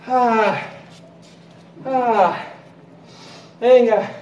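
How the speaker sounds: ordinary talk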